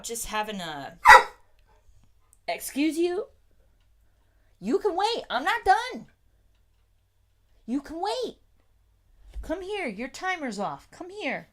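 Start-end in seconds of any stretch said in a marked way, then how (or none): 2.5-11.5 much higher, mock-stern talk to the dog; high scolding dog talk. Real, not acted. Owner tells the dog to wait in a high pet voice.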